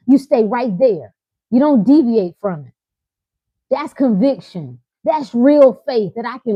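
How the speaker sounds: loud, driving preacher cadence; preaching at full force. Acted. Preacher urging his listeners not to break, loud and driving. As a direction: hammer each command like a sermon. Preaching conviction to live audience.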